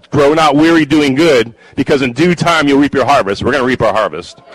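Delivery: loud, rousing stump speech; roaring rallying speech. Acted. Builds to rally close.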